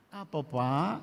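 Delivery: respectful gentle question; polite reply. Shown as a demonstration. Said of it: "Lihat bedanya".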